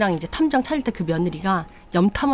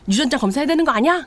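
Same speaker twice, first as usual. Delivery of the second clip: higher, louder suspicious demand; sharp demand; 7 semitones higher, 4 dB louder, faster than usual. Retold or imitated. Mother demanding a DNA test from her daughter-in-law, loud. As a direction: press her hard.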